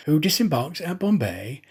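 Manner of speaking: usual speaking voice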